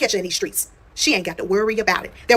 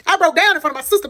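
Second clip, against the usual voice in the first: higher, tearful voice cracking on the words; voice breaking; 9 semitones higher than usual. Real, not acted. Telling viewers how she broke down, voice cracking. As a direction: let the memory choke you up.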